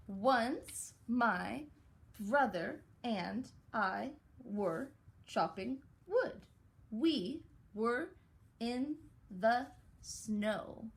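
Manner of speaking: put-on slow read-aloud to children; reading aloud slowly. Shown as a demonstration. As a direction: read each word slowly for kids. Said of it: "let's read it together".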